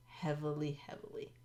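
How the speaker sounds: near-whispered, fading repetition; hushed emphasis. Real, not acted. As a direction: drop to a whisper to underline it. Repeats the word for emphasis.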